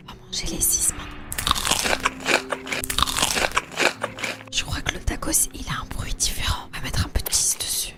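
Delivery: soft whispered eating commentary; ASMR whisper. Real, not acted. Vlogger lets viewers hear the food, soft ASMR whisper. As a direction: whisper closely to the listeners between bites.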